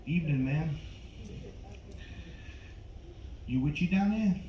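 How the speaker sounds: a light De Niro growl at the door; lightly voiced De Niro selling door to door; hint of a mobster salesman at the doorstep. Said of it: "my impression of Robert De Niro as a door-to-door Vagisil salesman".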